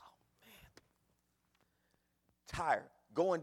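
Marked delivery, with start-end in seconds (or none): 0.0-0.7 soft whispered oh man; whispered dismay. Retold or imitated. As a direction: breathe out the letdown. Dismay at flat tire.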